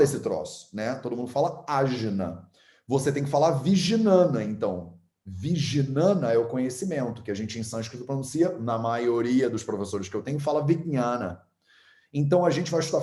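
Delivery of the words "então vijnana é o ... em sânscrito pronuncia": usual speaking voice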